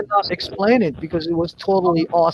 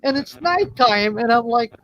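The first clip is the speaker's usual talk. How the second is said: ordinary delivery, nothing marked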